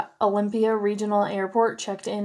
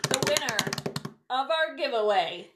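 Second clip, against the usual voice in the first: hyped giveaway announcement; 7 semitones higher than usual. Real, not acted. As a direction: belt it like a game-show host.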